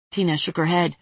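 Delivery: ordinary delivery, nothing marked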